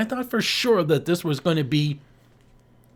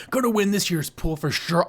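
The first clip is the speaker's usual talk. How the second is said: normal talking voice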